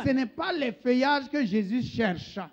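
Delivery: loud, ringing sermon cadence, one phrase at a time; forceful preaching. Acted. Preacher calls out to his congregation in a loud, ringing voice. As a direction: preach it out to the church, pausing for the interpreter.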